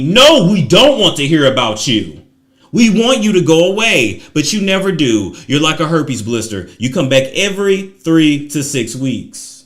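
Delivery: loud, indignant clapback; indignant rebuttal. Acted. Host answering Chrissy's apology, loud and fed up. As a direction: shout it back at the apology.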